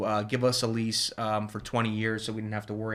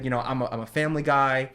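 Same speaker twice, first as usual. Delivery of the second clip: ordinary delivery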